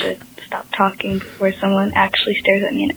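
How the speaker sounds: whispered, hushed in a library; whispering. Real, not acted. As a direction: keep your voice down so nobody stares.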